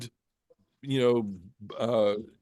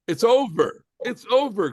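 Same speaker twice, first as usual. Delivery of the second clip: high, louder it's over; anguished cry; 9 semitones higher, 4 dB louder than usual. Acted. Moses's climactic ultimatum.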